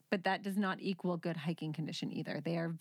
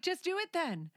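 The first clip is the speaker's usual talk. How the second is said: annoyed snap; 9 semitones higher than usual. Retold or imitated. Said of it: "I'm like".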